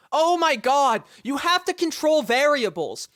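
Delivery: can't believe they skipped the basics; exasperated. Real, not acted. Streamer telling chat about the testing, exasperated outburst. Exasperated at obvious bad science.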